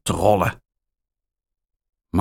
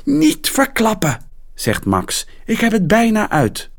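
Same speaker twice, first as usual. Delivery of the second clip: hoge, snelle jongensstem; gretige jongen. Acted. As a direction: roep het haastig als een jongen. Jongen wil einde niet horen. (Max roept snel dat Rita het einde niet mag verklappen)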